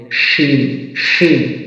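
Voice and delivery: শিন drawn out, mid-tongue to the palate; letter articulation demo. Shown as a demonstration. Teacher drills learners, শিন from mid-tongue and palate. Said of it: "জিহ্বার মধ্যখান অংশটি এবং উপরের তালুর".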